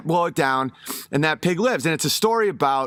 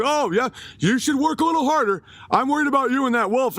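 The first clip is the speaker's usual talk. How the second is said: higher, quick, put-on caring voice; fake concerned pig; 8 semitones higher, faster than usual. Scheming pig warning his buddy, higher fake concern. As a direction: oversell the concern, pitched up. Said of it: "He's like".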